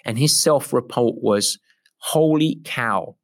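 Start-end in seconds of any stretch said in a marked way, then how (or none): 2.0-3.2 slow, drawn-out American Holy cow; mock American exclaim; American accent. Client's delight in an American accent. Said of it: "in my best American accent".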